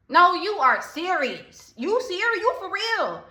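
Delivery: high, slow, disbelieving retort; incredulous challenge. Pretend. Challenging a joking friend.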